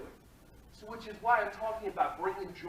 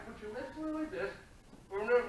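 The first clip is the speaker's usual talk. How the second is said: ordinary talk, nothing marked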